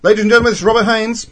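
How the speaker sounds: loud, high showman introduction; grand announcer. Acted. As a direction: announce him like a host.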